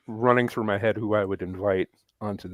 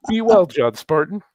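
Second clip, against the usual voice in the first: Demolition Man quote; 7 semitones higher, 10 dB louder than usual. Retold or imitated. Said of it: "I thought you were channeling Demolition Man".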